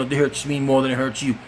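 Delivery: ordinary talk, nothing marked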